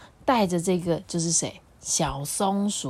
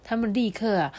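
usual speaking voice

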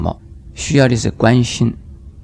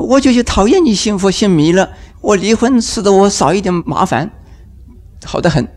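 higher, irritated retort; fed-up spouse; 7 semitones higher than usual. Acted. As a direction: snap back, fed up with the religion talk. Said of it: "他说". (不耐烦又恼火地回嘴)

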